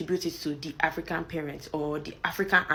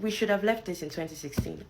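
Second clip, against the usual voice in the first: ordinary talk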